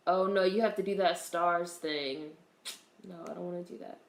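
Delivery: ordinary talk, nothing marked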